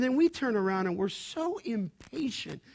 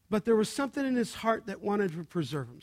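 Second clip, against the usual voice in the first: ordinary delivery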